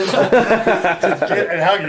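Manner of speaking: breaking into loud laughter mid-sentence; laughing. Real, not acted. Recalling the friend at the door, laughing hard. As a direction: laugh through the words, high and loud. Laughing while retelling the story.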